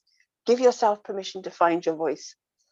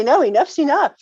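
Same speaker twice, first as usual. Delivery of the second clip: higher and louder, putting your foot down; firm, with an edge; 8 semitones higher, 7 dB louder than usual. Retold or imitated. To someone who keeps overstepping, firm and raised. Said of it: "Give yourself permission to be firm and say".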